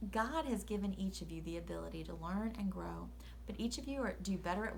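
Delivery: earnest hushed whisper. Real, not acted. Sincere message to children.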